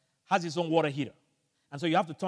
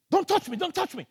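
urgent warning shout; 11 semitones higher, 3 dB louder than usual. Retold or imitated. Shouting warning while shocked.

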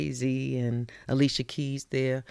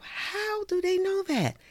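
puzzled to herself. Retold or imitated. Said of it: "I go to myself".

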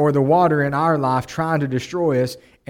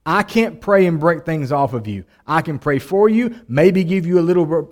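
ordinary talk

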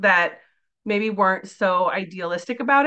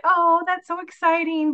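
mock gushing; 9 semitones higher than usual. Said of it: "they would kind of be like".

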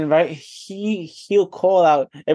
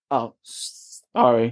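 normal talking voice

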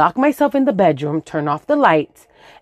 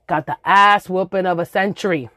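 normal talking voice

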